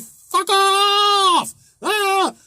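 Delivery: high, loud hammed-up yell of pain and anger; mock angry scream. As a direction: imitate her snapping at him in pain. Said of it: "She was like".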